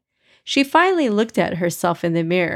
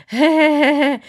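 mocking giggly hey hey, a bit higher; mock a friend's silly giggling; imitating giggle; 8 semitones higher, 5 dB louder than usual. Brother mocking his sister's friend's giggle. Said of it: "She'll be giggling like".

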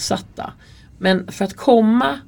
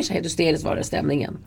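ordinary delivery

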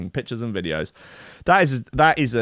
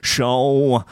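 put-on slow, drawn-out Manchester accent, pitched up; 4 semitones higher, slower than usual. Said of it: "I'm gonna do a sort of ... [name], Manchester accent".

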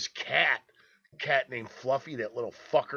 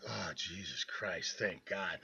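low, soft, slow sigh of relief; hushed relief; 6 semitones lower, 11 dB quieter, slower than usual. Retold or imitated. Hiding in the bathroom, relieved the mom left, hushed and slow. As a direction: breathe it out quietly, relieved.

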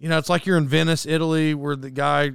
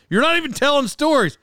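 high-pitched imitation of griping friends; raised-pitch, teasing protest; mock complaint; 9 semitones higher, 3 dB louder than usual. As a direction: heckle yourself as your friends would.

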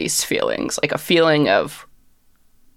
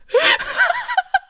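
high-pitched creature cackle; cackling. Acted. Gordon cackles before going inanimate, high cackle. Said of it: "And he starts cackling".

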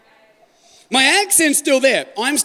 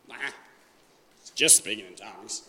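bored; 13 semitones lower, 13 dB quieter than usual. Retold or imitated. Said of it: "you're like".

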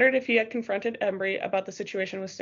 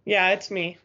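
plain reading voice

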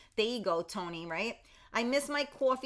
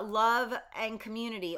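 ordinary talk, nothing marked

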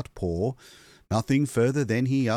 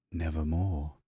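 croaking; 6 semitones lower, 5 dB quieter than usual. Acted. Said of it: "meant in croaking".

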